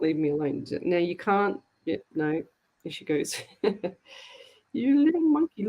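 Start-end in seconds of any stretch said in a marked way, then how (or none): 4.7-5.7 fond pet voice. Real, not acted. Talking fondly to her cat.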